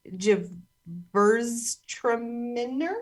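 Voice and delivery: "Gewürztraminer" dragged out slowly as chiv-verz-tray-nuh; botched pronunciation. Shown as a demonstration. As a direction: stumble slowly through "Gewürztraminer", rising. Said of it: "Nope. That's not right".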